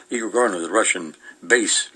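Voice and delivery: ordinary talk, nothing marked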